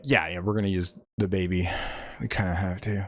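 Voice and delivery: low, subdued, sheepish reply; reluctant admission. Retold or imitated. Father admitting to mother they must use the baby, sheepish. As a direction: drop low and soft to own up.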